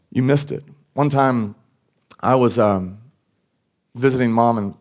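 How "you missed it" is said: sighing disappointment. Acted. God to people who were disobedient, sighing disappointment. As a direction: sigh first, then let the words drop sadly. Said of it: "he sighs".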